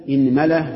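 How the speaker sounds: إنملة as "inmala", i first, a on the meem; list-like demo of إنملة as inmala; i then a. Shown as a demonstration. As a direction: say إنملة as IN-ma-la, rising. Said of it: "اكسر الهمزة".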